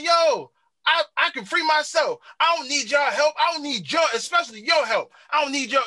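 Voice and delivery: high, insistent mock protest; indignant refusal. Host acting out the father refusing his rescuers' help, indignant high protest.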